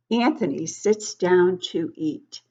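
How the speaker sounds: plain reading voice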